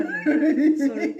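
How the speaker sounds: a loud laugh through the word; laughing apology; laughing sorry. Real, not acted. Laughing at her own apology.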